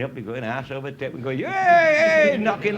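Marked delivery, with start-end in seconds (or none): 1.4-2.8 high, loud hunting cry; beater's call. Retold or imitated. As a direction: call out to flush the game. Beaters calling in the wood.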